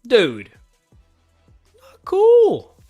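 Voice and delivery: ordinary talk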